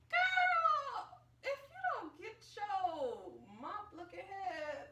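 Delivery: high, loud sobbing cry; crying outburst. Retold or imitated. Girl retelling her failed reading score, crying high. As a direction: wail it out through tears.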